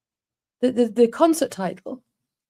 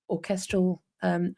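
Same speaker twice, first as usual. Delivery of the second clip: ordinary talk, nothing marked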